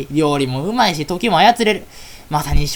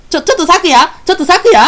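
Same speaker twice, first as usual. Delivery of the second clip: calling out loud; 8 semitones higher, 6 dB louder than usual. Acted. Fairies calling out to Sakuya in the street, loud calling. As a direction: shout her name high and loud.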